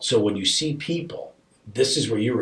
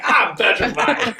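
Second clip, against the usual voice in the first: high, loud, drawn-out cry of fright; hammed-up panicked shout; mock terror; 13 semitones higher, 6 dB louder, slower than usual. Dad joking about his hidden fear to the host, mock terrified. Confesses inner fear in retelling.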